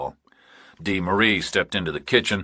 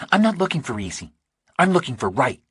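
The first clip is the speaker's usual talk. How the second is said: higher, lighter woman's voice, unhurried; Dee Marie's voice; 6 semitones higher than usual. A narrator's reading. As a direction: read her as a steady, practical woman.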